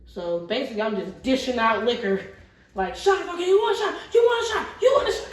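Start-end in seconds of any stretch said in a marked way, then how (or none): 2.9-5.3 high, loud shouted offer of shots, over and over; calling out shots. Retold or imitated. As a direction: bellow it over the party noise like a bartender hustling. Hawking free shots at party.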